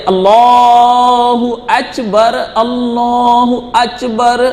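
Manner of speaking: put-on slow drawn-out Allahu Akbar with a foreign accent; imitating a foreign imam. A preacher mocks a Madhya Pradesh imam's stretched Allahu Akbar. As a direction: mimic the reciter's slow, stretched call in his accent. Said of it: "وہ ضاد کو جیم سے پڑھ رہا تھا".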